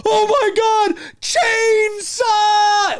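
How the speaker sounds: high-pitched panicked mock scream; screaming kids. Kids fleeing a lawnmower, imitated terrified scream. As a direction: shriek in hammed-up terror, stretching the last word. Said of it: "all I hear from the kids is".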